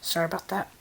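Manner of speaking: ordinary delivery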